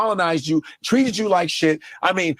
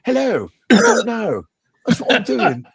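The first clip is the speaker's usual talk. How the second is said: プリティッシュではなく、イギリス英語風に気取って話す; mock posh British accent, higher pitch; 4 semitones higher than usual. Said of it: "when you have a British accent".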